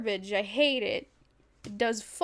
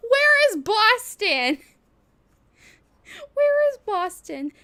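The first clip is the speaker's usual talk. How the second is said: put-on loud, high, slow Boston accent; 11 semitones higher, 9 dB louder, slower than usual. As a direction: ham up a Boston tough guy. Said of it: "I think it is Boston".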